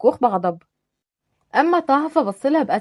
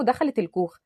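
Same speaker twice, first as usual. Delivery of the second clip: plain reading voice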